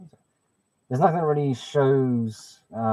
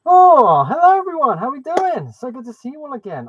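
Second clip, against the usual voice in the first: excited greeting; 11 semitones higher, 8 dB louder than usual. Real, not acted. Streamer greets chat on arrival.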